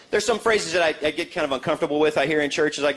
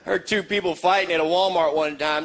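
usual speaking voice

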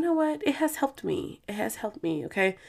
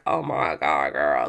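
much lower, mock-gruff oh my God girl; deep put-on voice; 3 dB quieter, slower than usual. Host gushes to herself in a low joke voice. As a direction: drop into a deep joking voice. Gushing about prison release.

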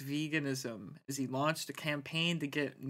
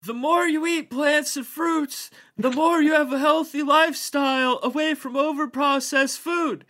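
Eric Adams impression; 11 semitones higher, 9 dB louder than usual. [name] reading the mayor's quote in his voice. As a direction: deliver it like the mayor at a podium. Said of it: "how would Eric Adams read that".

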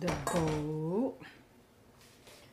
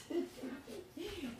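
embarrassed giggle; 9 semitones higher, 6 dB quieter than usual. Real, not acted. Laughs at own singing.

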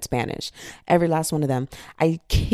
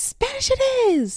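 very high, excited squeal; triumphant announcement; 4 dB louder than usual. Acted. Choosing friends' language over family's, triumphant high cry. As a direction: sing out the decision in a high voice.

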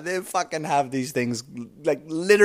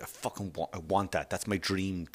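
much softer, hushed wanting; mock covetous longing; 6 semitones lower, 11 dB quieter than usual. Comic mocking a materialistic person, hushed longing. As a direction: murmur it like a daydream.

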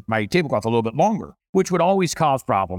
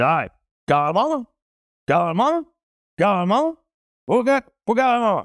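waiter voice; 9 semitones higher than usual. Retold or imitated. Waiter calling out sing-song.

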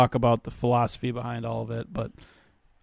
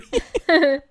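high burst of laughter; 4 dB louder than usual. Real, not acted. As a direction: squeal of a laugh. Laughs after mock rant.

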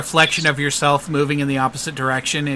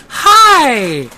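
high, loud bubbly "Hi!"; bubbly lady greeting; 11 semitones higher, 10 dB louder than usual. Acted. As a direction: burst out with a sugary high greeting. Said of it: "She goes".

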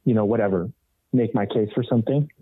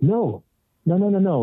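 helpless repeated no; 8 semitones higher than usual. Retold or imitated. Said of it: "that's all I could muster".

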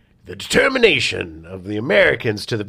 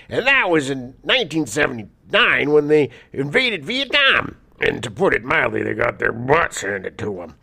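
Milius; 3 semitones higher than usual. Acted. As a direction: declaim it like an indignant quotation. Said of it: "Milius said".

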